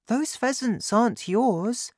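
put-on high-pitched child's protest; high childlike voice; objecting boy. A narrator's reading. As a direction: point it out as a troubled small boy. Boy objects to father's poaching.

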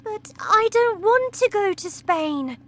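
put-on girl protesting to her father; distressed cry. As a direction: cry out against it. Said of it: "Matilda cried".

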